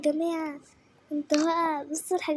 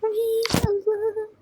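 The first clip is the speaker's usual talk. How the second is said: delighted; 6 semitones higher, much slower than usual. Real, not acted. Delighted exclamation.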